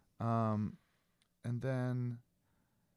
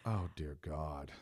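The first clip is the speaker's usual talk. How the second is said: weary groan; 8 semitones lower, 9 dB quieter, slower than usual. Retold or imitated. Reaction to sticker gift.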